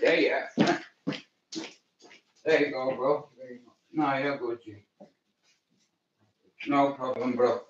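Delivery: quiet aside. Real, not acted. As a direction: speak away from the mic to a friend.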